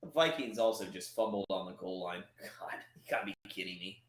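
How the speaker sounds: hushed, breathless whisper; whispered aside. Real, not acted. Fan whispering to viewers about another game's fumble. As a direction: drop to a whisper in disbelief.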